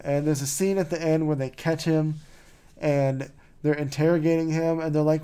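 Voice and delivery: normal talking voice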